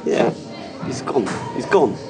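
ordinary talk, nothing marked

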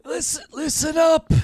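high, strained call to a noisy room; king calling for attention. Acted. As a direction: try to be heard over the squabbling. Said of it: "listen to what he's saying".

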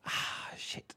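unvoiced "Ah, shit"; whispered curse. Real, not acted. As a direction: whisper it to yourself, caught out. Realising wrong answer.

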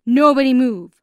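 masked robber yelling at the family; shouted command. Acted. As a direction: shout it to freeze the room. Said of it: "one of them shouted".

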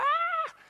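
high shrill-free squeal of fright, hammed up; mock scream. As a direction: shriek like a scared girl, playful. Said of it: "disiplene bare sitter og hyler".

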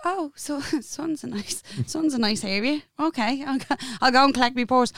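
hushed feigned nonchalance with a giggle; mock-casual. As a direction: play it coolly impressed, keeping it small. Acting casual about a posh address.

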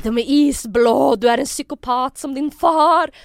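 very loud, slow mock scream; imitated loud scream. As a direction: scream the accusation, stretching each word. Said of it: "Och skriker skithögt".

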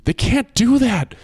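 put-on a frightened boy shouting no, drawn out; desperate protest. Boy protests them taking bodies.

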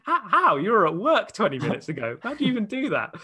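high, loud mock incredulity; baffled disbelief. Replying to mum's sudden trip news, acted bewilderment. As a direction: react to mum as if utterly stunned, playfully.

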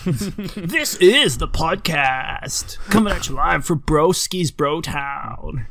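very slow, stretched radio DJ; nineties radio voice. Acted. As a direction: drawl it like a nineties DJ. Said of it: "the nineties radio".

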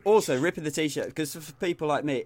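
ordinary talk, nothing marked

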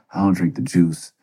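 ordinary talk, nothing marked

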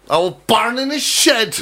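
high mock Kerry, born as barn; Kerry accent. Echoing a friend's correction, high broad Kerry accent. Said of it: "Is that Kerry?".

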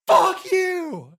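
high, loud shout down a hallway; distant angry yell. Acted. Prisoner down the hallway to the party, yelling. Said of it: "hear a voice from down the hallway yell".

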